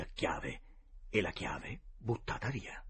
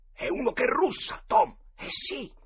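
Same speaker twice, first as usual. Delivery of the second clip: startled realisation; 8 dB louder than usual. A narrator's reading. Boys realise someone is snoring.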